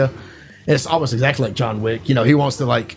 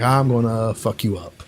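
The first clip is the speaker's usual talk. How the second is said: low, slow, understated threat; retold low threat; 4 semitones lower, 3 dB quieter than usual. Acting the hero facing bus thugs, low threat. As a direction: say it slowly and low, imitating menace.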